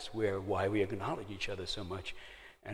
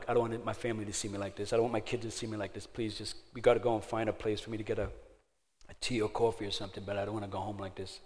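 fast, tumbling words of begging; rushed plea; faster than usual. Retold or imitated. Drunk son-in-law begging not to go home, fast and urgent. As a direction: hurry the plea out before he drives home.

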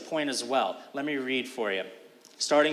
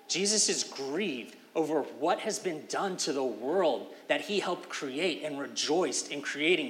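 ordinary talk

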